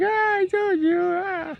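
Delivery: squeaky high mom voice, told-you-so; nagging mom. Retold or imitated. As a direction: imitate mom gloating way up high. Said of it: "And she's like".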